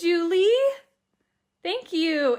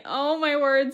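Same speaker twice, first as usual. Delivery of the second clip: ordinary delivery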